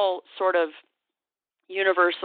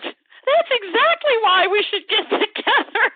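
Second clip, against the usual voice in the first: high, slow and louder, breaking into a laugh; laughing confidently; 6 dB louder, slower than usual. Retold or imitated. Rep retelling his winning reply to the trainer, laughing. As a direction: say it through laughter, delighted. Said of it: "And he said".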